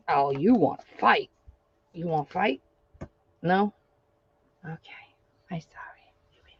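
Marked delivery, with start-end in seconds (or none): 4.6-6.5 whispered apology. Real, not acted. Soft apology to baby.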